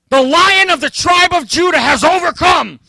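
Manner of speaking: loud, high triumphant proclamation; proclaiming. Acted. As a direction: announce the victor loudly. Proclaiming lion's victory.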